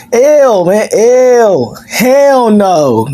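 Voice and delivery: high, loud grossed-out "ew"; disgusted. Real, not acted. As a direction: recoil in disgust. Disgusted rejection.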